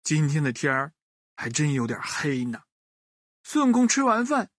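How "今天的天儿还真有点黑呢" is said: ordinary delivery, nothing marked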